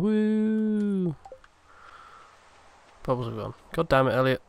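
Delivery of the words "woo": high whoop of joy; cheer. Real, not acted. Player breaking even, cheerful whoop. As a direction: let out a happy whoop.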